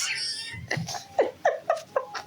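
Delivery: high, helpless laugh. Retold or imitated. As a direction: break into high giggling. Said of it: "That's how I laughed in the car".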